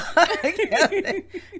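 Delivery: high laugh. Real, not acted. Laughing at own story.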